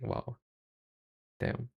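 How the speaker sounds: ordinary talk, nothing marked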